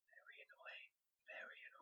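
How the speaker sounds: breathy whisper with no voice at all; whispered aside. Acted. Tells his co-host Americans are annoying, in a whisper. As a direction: drop to a secret whisper, as if sharing gossip.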